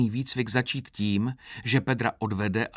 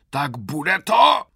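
loud, high, drawn-out demand; roared; 6 semitones higher, 8 dB louder, much slower than usual. A narrator's reading. Sergeant to a dawdling recruit, impatient roar. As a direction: roar impatiently, stretching the words. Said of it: "zařval Krpata".

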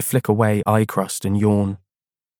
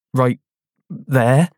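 unconvincing; 4 semitones higher, 4 dB louder than usual. Acted. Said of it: "I sound unconvincing".

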